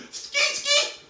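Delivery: playful high skeet; high skeet squeak. Pretend. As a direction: toss off a quick squeaky noise. Skeet noise again.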